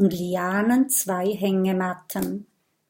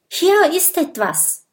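high excited call of discovery; sudden call; 9 semitones higher, 4 dB louder than usual. A narrator's reading. Mathilda suddenly calls out her find while digging. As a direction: blurt out the find. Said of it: "ruft Mathilda plötzlich".

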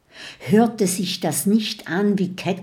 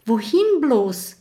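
high-pitched, moderately raised cry; mild cry; 5 semitones higher, 3 dB louder than usual. A narrator's reading. Mathilda flees the skull, crying out with mild alarm. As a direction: cry out in a storybook panic. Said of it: "schreit Mathilda".

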